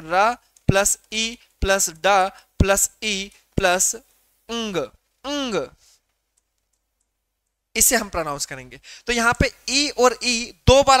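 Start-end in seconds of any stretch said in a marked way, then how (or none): 0.0-5.7 "र ... ई ... ड ... ई ... ंग" one drawn-out sound at a time; teacher spelling out sounds slowly, with pauses. Shown as a demonstration. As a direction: slowly break the word into separate sounds, lingering on "ंग". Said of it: "हम इसे pronounce करेंगे".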